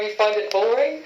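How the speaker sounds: ordinary talk, nothing marked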